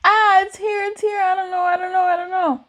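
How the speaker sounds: high, breathless-sounding run of panic; hammed-up panicked repetition; freaking out. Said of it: "also freaking out".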